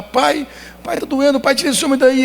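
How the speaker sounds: put-on very high, desperate child's plea; crying little boy. Toddler screaming at his father to remove the doctor, very high. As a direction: cry out for your father to make it stop. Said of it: "Eu falei".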